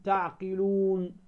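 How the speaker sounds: تعقلون with the long vowel stretched slowly; drawn-out madd. Shown as a demonstration. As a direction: draw out the و in تعقلون like a recitation. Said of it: "في أي كلمة فيها تعلمون".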